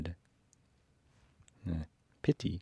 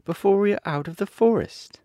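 put-on high little-girl voice, asking; a young girl wondering aloud; small girl asking how long; Dorothy, a child; 6 dB louder than usual. A narrator's reading. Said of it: "the child asked".

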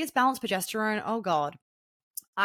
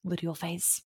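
inner mantra; 2 semitones lower, 5 dB quieter than usual. Shown as a demonstration. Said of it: "in your head you just go".

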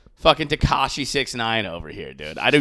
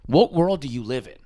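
normal talking voice

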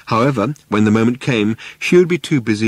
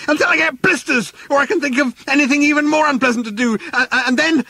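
very high, fast breathless threats; furious running yell; faster than usual. Acted. Arthur yelling while running after the bulldozers, high fast rant. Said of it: "yelled Arthur, still running".

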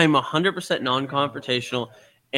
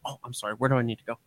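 meek, softer apology; 3 semitones lower, 8 dB quieter, much faster than usual. Retold or imitated. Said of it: "I'm like".

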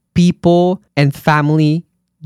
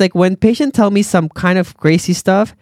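ordinary talk

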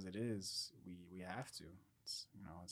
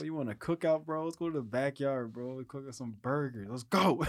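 loud, higher teasing call-out; mock taunt; 5 semitones higher, 8 dB louder than usual. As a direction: goad him to come outside, playfully. Taunting the man to come out.

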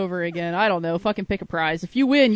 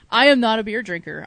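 usual speaking voice

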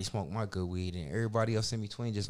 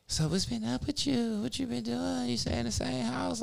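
imitating her; 13 semitones higher than usual. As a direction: ask it sweetly in her high voice. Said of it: "she was like".

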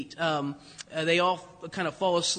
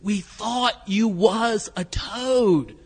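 louder, slow, thrilled exclamation; excited exclaim; 2 semitones higher, 5 dB louder, much slower than usual. Retold or imitated. Delbert turning to his long-lost friend, a slow, loud, thrilled exclamation. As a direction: blurt it out, overjoyed to see him. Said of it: "He turns around and he says".

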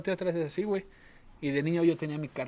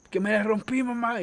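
imitating crying child; 5 semitones higher than usual. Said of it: "Y yo llorando así".

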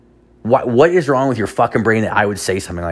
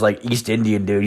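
ordinary talk

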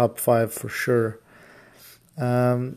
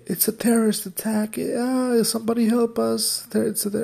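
mock panicked screaming; 11 semitones higher, 4 dB quieter than usual. Women storm into a grocery store crying for help, mock panicked. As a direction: imitate panicked screams in a restrained, high voice. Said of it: "she was screaming that they needed help".

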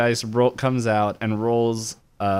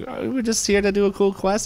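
high goofy voice; 10 semitones higher than usual. Retold or imitated. Characters voiced in a recap.

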